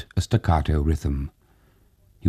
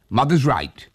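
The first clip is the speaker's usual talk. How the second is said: pleased; 7 semitones higher than usual. A narrator's reading.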